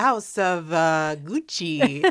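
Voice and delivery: put-on Gucci drawn out in a playful Italian accent; mock Italian accent. Podcaster naming the film title for a co-host, mock Italian accent. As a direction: say it with a hammed-up Italian lilt.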